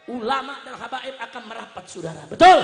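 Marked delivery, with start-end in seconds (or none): 2.3-2.6 sudden high, very loud call; one-word crowd check at full volume; rallying shout. Real, not acted. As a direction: bellow it for agreement. Calling crowd for agreement.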